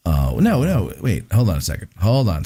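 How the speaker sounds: ordinary delivery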